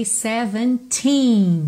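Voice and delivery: put-on "Seventeen" stressed on the last syllable, "teen" drawn out long; long final teen. Shown as a demonstration. Teacher showing where the stress falls in "Seventeen". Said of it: "é compridinho esse som".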